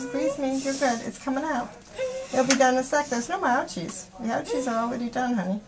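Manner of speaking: soothing. Real, not acted. Mom to frightened child during blood draw, soothing.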